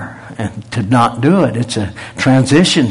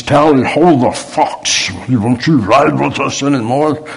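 put-on friends hamming up a British accent, slow and a bit higher; mock British accent; 3 semitones higher, 3 dB louder than usual. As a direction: tease him in a light fake British accent. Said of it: "And they says".

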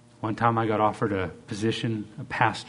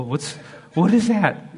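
usual speaking voice

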